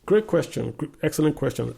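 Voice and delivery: hearty "great question"; enthusiastic praise. Real, not acted. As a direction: greet the question with loud delight. Host praising caller's question.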